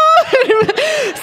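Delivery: high, loud laugh close to tears; crying laugh. Real, not acted. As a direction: laugh until it nearly turns to tears. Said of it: "we're just laughing".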